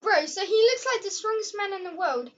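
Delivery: high, loud excited joke; excited joking. Real, not acted. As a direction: hype it up laughing. Excited joking about the video.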